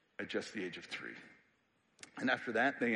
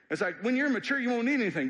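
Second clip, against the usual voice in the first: sarcastic; 12 semitones higher, 7 dB louder, faster than usual. Retold or imitated. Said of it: "he's being sarcastic here".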